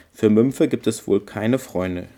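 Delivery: ordinary delivery, nothing marked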